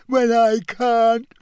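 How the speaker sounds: very high, slow, broken wail; shrill self-pitying ghost. A narrator's reading. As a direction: wail each phrase as if near tears. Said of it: "she said shrilly".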